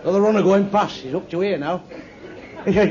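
ordinary talk

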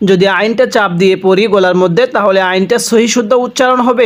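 ordinary talk, nothing marked

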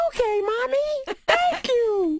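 high, slow teary cub voice; Elmo voice. Acted. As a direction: squeak it like a weepy bear cub. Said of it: "everything he does sounds like Elmo".

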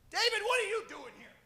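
a hint of a sneering brother; scolding big brother. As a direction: tease him as the eldest. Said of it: "that's what he said".

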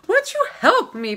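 high pleading plea; lightly voiced pleading woman. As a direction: beg him sweetly up high.

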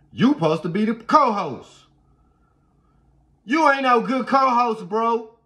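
mock scolding shout. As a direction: yell at the lazy co-host, joking. Yelling at baby co-host.

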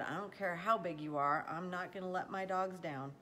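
usual speaking voice

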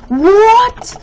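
high, loud shriek of disbelief; shocked outcry. Real, not acted. Gamer startled mid-fight, shocked shriek.

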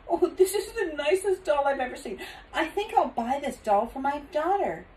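high, delighted lady's voice; gushing lady. A narrator's reading. Lady picks a doll for her daughter, gushing.

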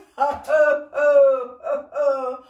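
very loud laughing burst; loud laughter. Shown as a demonstration. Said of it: "Keep going".

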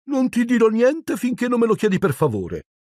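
annoying sing-song. A narrator's reading. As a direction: tease him in a nagging chant. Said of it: "con la sua fastidiosa cantilena". (cantilena fastidiosa e acuta)

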